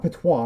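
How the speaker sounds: "patois" as pa-TWAH; French pronunciation. Shown as a demonstration. Querying the dialect's name, "patois" as French pa-TWAH. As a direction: say "patois" French-style, silent S. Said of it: "It's not French".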